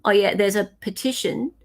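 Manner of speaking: ordinary talk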